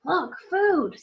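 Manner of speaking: bright eager exclamation. Acted. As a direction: spot the food gladly. Ron spotting food.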